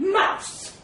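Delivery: loud, high, commanding call; cold address. A narrator's reading. Elephant interrupting the mouse, loud and cold. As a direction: cut in sternly. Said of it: "said elephant coldly".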